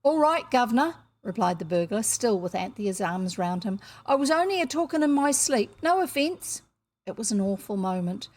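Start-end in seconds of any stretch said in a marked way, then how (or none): 0.0-1.0 Cockney burglar. A narrator's reading. Burglar answers the guard. 4.0-6.6 Cockney burglar. A narrator's reading. Burglar excuses himself.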